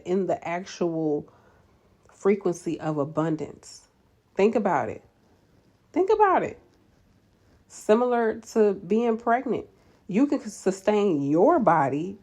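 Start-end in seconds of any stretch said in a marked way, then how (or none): 5.9-6.6 high, loud, emphatic push; insistent urging. Real, not acted. Urging nurses to consider their abundance, high insistence. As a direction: drive the point home with a leap in pitch.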